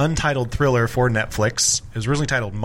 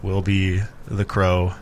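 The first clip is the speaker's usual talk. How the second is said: news announcer; slower than usual. Pretend. Mock announcement.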